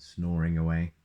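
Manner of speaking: ordinary delivery, nothing marked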